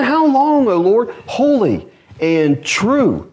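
high, slow drawn-out plea; pleading cry. Acted. Persecuted saints crying to God, slow plea. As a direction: stretch out the cry. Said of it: "they're crying out with loud voices".

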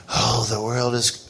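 low, soft mopey moan; gloomy Eeyore. Retold or imitated. Preacher mocking pessimists as Eeyore, low and mopey. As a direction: sigh it like a gloomy donkey. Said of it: "we're not all Eeyore".

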